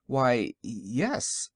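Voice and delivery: slow, halting stammer. A narrator's reading. Wizard answers the invisible woman, stammering. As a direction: stumble over "yes", taken aback. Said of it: "stammered the Wizard".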